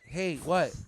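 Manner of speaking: loud, irritated pickup; attitude. Retold or imitated. Girlfriend answering repeated calls, with attitude. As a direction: mimic a girlfriend who resents the call. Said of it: "she's answering with attitude".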